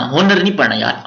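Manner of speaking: ordinary talk, nothing marked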